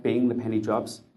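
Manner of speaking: usual speaking voice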